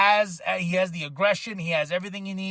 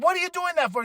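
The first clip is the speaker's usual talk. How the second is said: much higher, incredulous challenge; a partner who can't believe it; retold Kevin Owens, higher, exasperated; 11 semitones higher, faster than usual. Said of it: "He's like".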